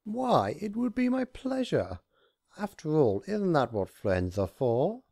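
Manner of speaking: high, over-sweet reassurance; theatrical high sweetness; sugary Discord. A narrator's reading. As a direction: lay the charm on thick. Discord voiced, pitched up.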